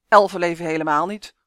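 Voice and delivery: grumpy, contrary and forceful; sulky. A narrator's reading. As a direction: snap back stubbornly. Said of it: "antwoordde Justin bokkig".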